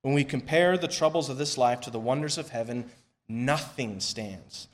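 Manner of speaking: normal talking voice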